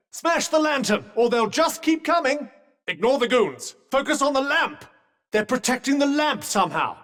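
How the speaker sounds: high, insistent combat instructions; urgent battle coaching. Acted. Companion directing Frey to smash the lamp, urgent. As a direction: press the point urgently over a fight. Urging companion during a fight.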